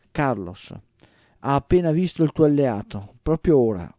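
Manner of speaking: ordinary delivery, nothing marked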